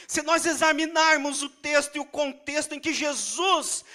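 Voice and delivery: loud, high fervent sermon voice; preaching at full force. Acted. Pastor urging the congregation to love, loud and fervent. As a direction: preach it out to the whole church.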